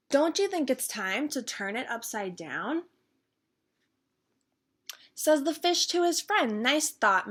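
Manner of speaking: put-on lively storytime read-aloud for kids; bouncy children's book reading; reading a picture book aloud. A narrator's reading. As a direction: read it like storytime for little ones. Reads picture book to viewers.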